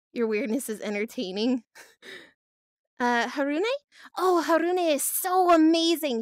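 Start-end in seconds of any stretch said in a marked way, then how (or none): 4.2-6.2 higher, louder enthusiastic gush; emphatic admiring burst; gushing praise. Real, not acted. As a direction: rave about a friend you adore.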